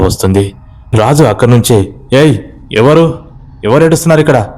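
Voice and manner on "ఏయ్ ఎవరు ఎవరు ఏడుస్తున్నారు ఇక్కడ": raised voice, calling into the dark; high-pitched call into the night; loud calling out. Acted. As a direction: shout out who is there. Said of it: "గట్టిగానే అరుస్తూ".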